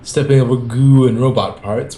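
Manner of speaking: ordinary delivery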